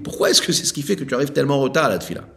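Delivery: fast, eager quoted question. Retold or imitated. Rabbi retells the question put to the late man, fast. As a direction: rattle off the question as asked. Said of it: "C'est ce qu'il a répondu".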